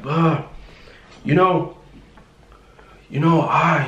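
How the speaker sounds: mock a worked-up yeller; retold yelling. Imitating the Uber driver cutting her off, mild mock yell. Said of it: "like, yelling at me".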